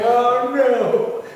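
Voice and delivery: high, loud mock dismay; alarmed oh no. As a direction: cry out in embarrassed alarm. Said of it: "I was going".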